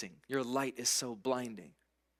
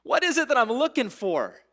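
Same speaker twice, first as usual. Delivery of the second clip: much higher and louder, pleading and searching; anguished seeker; 10 semitones higher, 9 dB louder than usual. Acted. Preacher voicing a searching seeker at the well, high and loud. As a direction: cry out like someone lost and searching. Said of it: "who is saying".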